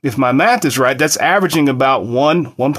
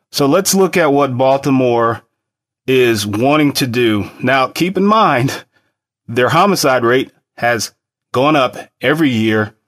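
ordinary talk

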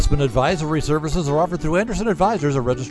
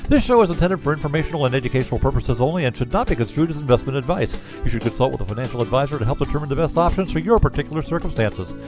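rapid-fire compliance read; fast legal disclaimer. Acted. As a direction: rattle off the disclaimer quickly. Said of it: "recorded disclaimer read".

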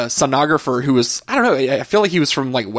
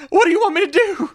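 much higher, strained mock-panic yelp; exasperated squeal. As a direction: squeak it out in breathless disbelief. Retold exasperated outburst at sonographer.

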